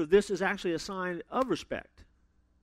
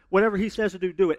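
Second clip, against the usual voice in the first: brisk instruction; 7 dB louder, faster than usual. Retold or imitated. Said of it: "turns to the people there and goes".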